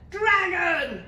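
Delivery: high, loud princess yell. A narrator's reading. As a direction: yell it out like an excited demand. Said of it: "yelled the little princess".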